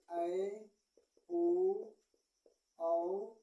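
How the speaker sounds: each vowel said slowly and separately; reciting Hindi vowels. Shown as a demonstration. Teacher reciting the Hindi vowels to students. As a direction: say अ, आ, ई, उ, ए, ऐ, ओ, औ one by one with pauses. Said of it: "जैसे".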